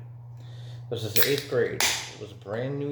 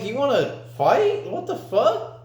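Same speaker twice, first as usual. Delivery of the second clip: high, heated retold challenge; worked-up, high-pitched confrontation; fighting challenge; 12 semitones higher than usual. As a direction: act out squaring up for a fight.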